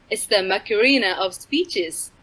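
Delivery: usual speaking voice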